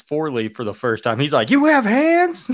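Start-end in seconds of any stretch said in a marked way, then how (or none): 1.5-2.5 high-pitched astonished question; mock disbelief. Actor sees his friend for the first time, high mock astonishment. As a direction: blurt it out high, imitating shock. Retold character's shock at seeing hands.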